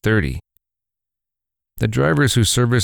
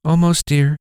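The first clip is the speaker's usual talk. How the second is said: lightly higher, gentle mother; 8 semitones higher than usual. Acted. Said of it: "the mother said".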